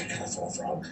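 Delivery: breathy whisper to himself; whispered thought. Retold or imitated. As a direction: whisper the temptation. Inner urge while fishing.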